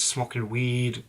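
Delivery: usual speaking voice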